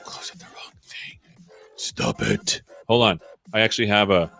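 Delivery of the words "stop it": normal talking voice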